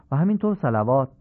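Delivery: normal talking voice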